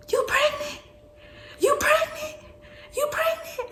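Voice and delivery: very high shocked shriek as mom. Retold or imitated. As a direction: mom can't believe it, squealing high. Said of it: "So she like".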